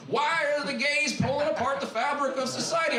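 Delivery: mock Southern preacher yelling; hammed-up Southern accent outrage. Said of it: "I did that for my Kentucky friends in the Southern accent".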